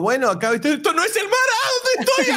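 high squeaky lost penguin; confused penguin voice. Retold or imitated. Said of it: "la confusión del pingüino diciendo".

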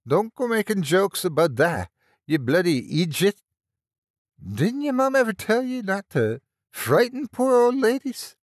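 high, loud, angry Irish scolding; full Irish accent. A narrator's reading. Frightened nurse scolds the lad for joking, full Irish accent. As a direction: scold like an outraged old Irish woman. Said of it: "her full Irish accent flaring up".

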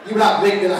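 ordinary talk, nothing marked

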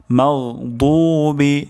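slow মাগদুবি with throaty gh and thick d; correct heavy daad. Shown as a demonstration. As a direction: stretch the heavy 'doo' in মাগদুবি. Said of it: "দদ এখানে মোটা হবে". (টেনে, মোটা দদে মাগদুবি)

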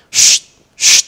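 two loud, sharp voiceless shushes; panicked hissing. Retold or imitated. Retelling a possessed friend's odd reaction, imitated panicked hissing. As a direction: hiss "shh" twice, imitating someone in a panic. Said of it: "kayak orang panik gitu".

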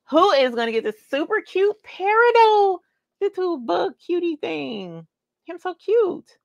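put-on high, cooing baby talk over something adorable. Host gushing to viewers about a cute bag, high baby talk. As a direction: coo it like a baby voice.

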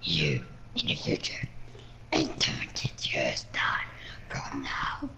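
slow, unvoiced ominous whisper; whispering villain. Villain revealing the future to the partygoer, ominous whisper.

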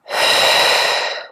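long, audible breath out through pursed lips; mouth exhale. Shown as a demonstration. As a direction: blow the air out steadily. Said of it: "exhale out through the mouth with pursed lips".

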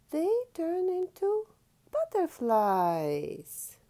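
soaring wonder; wonder reveal. Acted. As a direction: stretch the magic word. Story reveal.

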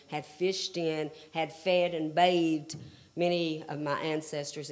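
ordinary talk

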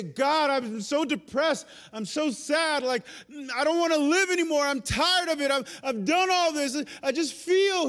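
high, loud, strained lament; anguished prayer. Retold or imitated. Believer crying out to God, high anguished lament. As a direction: pour out despair, voice climbing. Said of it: "So when we say".

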